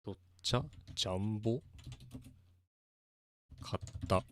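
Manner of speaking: Tsugaru-ben from Aomori, quiet and clipped at the end; Aomori dialect. Shown as a demonstration. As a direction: read it like a northern Aomori local. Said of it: "So this is Aomori dialect".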